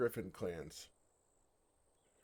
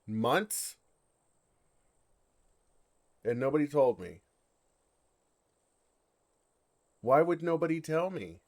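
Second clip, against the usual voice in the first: louder, rising disbelief, almost shouting; indignant outburst; 4 semitones higher, 9 dB louder than usual. Acted. Dragon protesting to the woman, loud and indignant. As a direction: demand an answer, wounded and loud. Dragon learns everyone knew.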